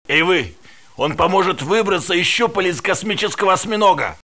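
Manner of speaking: high, loud desperate call; panicked plea; calling for help. Acted. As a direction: shout across for help.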